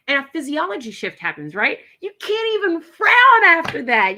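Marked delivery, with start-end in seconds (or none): 2.0-4.2 excited. Real, not acted. Excited coach.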